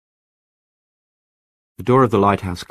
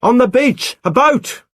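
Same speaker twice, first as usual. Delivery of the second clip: high, loud urgent call; excited yell from far off; shouting; 7 dB louder, slower than usual. Acted. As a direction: shout the news down to her. Calling out from lighthouse gallery.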